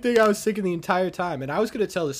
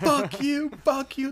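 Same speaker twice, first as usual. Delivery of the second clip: high-pitched mock outburst; hammed-up indignant retort; defiant cursing; 9 semitones higher, slower than usual. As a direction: imitate an irate woman refusing help. Acting the woman cursing EMTs.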